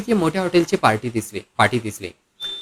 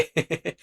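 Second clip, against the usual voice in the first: brief soft laugh; quiet knowing laugh; teasing chuckle; 9 dB quieter than usual. Acted. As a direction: chuckle slyly after the remark. Receptionist teases the departing couple.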